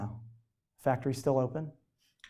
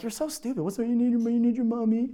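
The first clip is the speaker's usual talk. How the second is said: mocking brothers; 7 semitones higher than usual. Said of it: "And they're like".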